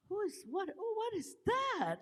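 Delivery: high, astonished squeal of wonder; amazed. Retold or imitated. Seeing a mermaid video on Instagram, high amazed squeal. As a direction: gasp it out like you can't believe your eyes.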